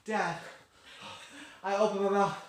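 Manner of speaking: high, loud, hammed-up begging; pleading kid. Kid begging Dad for a curfew, mock pleading. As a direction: play a child panting for rules from Dad. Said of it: "gone to your parents and be like".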